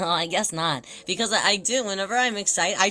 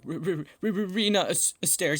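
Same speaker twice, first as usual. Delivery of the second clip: flustered stammer. Retold or imitated. Flustered fan answers her demand.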